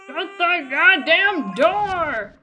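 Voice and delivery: high, loud, stretched-out scream; drawn-out furious yell. Acted. Game player voices a crazy order to close the door, yelling. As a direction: bellow it slowly, every word dragged out. Voicing a game character's outburst.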